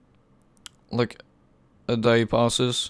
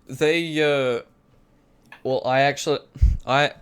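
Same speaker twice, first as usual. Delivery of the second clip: ordinary delivery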